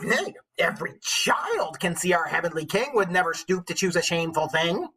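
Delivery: storybook witch voice declaiming Chaucer. Acted. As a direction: recite it like a wise old witch. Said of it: "go back into my witch voice".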